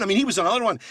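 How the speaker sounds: ordinary delivery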